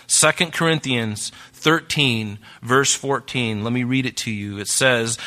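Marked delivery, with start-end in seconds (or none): 0.1-3.6 slowed down, each word spaced out for note-taking; slow dictation pace. Shown as a demonstration. Preacher slowing so the congregation can write, slow and deliberate. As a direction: read the reference slowly so they can write it. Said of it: "Write this in the margin".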